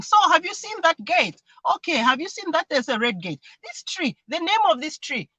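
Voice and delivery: put-on high, animated questions for a child; acted car-ride chatter with a youngster; chatting to child. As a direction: point things out in a lifted singsong. Mother chatting to son in car.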